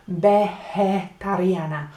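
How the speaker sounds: Vegetariana as behetariana, b and throaty j; slow demo with b start; slow Spanish pronunciation. Shown as a demonstration. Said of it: "They say veje".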